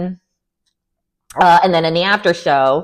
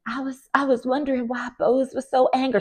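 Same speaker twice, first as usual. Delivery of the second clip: softer, higher, shrinking voice; mock scared; 6 semitones higher, 7 dB quieter than usual. Cast member acting scared of a castmate, mock timid. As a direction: imitate someone making herself small. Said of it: "acted like she was scared".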